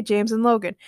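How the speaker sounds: plain reading voice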